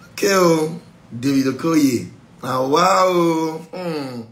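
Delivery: loud drawn-out mock wonder; mock amazement. God loudly mock-impressed by David Okoye. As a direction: exclaim like an impressed onlooker.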